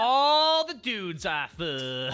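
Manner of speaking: drawn out, loud and slow; slow loud emphasis. Acted. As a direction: stretch every word for effect. Slowly repeating a funny answer.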